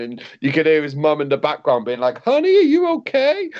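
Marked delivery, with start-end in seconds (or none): 2.2-3.5 high, loud mock mum voice; imitating a fretting mother, pitched way up; worried mum. Mum calling from the background, mock worried high voice.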